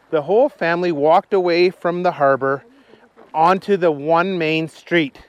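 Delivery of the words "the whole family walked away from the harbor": ordinary delivery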